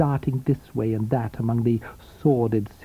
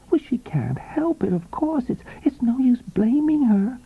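higher, eager, sympathetic woman's voice; eager knowing woman; 12 semitones higher than usual. A narrator's reading. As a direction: agree with relish. Coral's voice in the story.

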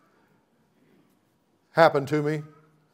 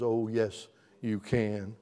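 tender hush; 4 semitones lower, 10 dB quieter, slower than usual. Acted. Soft answer after world's voice.

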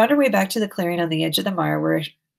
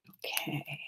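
real whisper to herself; whispered okay; 3 semitones lower, 18 dB quieter than usual. Real, not acted. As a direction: breathe the word almost silently. Reader's aside after recording.

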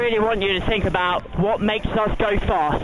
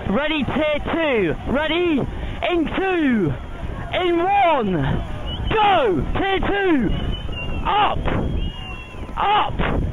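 shouting; 4 semitones higher, slower than usual. Real, not acted. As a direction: build the count to a roar. [name] counts into the push.

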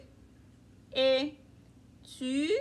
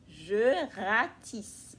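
slow repeat; 4 semitones lower, much slower than usual. Shown as a demonstration. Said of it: "Dans le jardin?".

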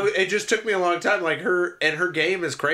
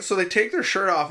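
ordinary talk, nothing marked

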